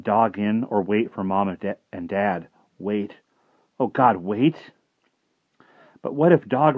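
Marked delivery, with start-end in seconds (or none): none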